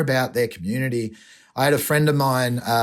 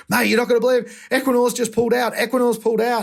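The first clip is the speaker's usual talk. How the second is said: high, fast, thrilled news on the phone; excited friend; 9 semitones higher, faster than usual. Retold or imitated. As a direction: blurt out the amazing news in a rush.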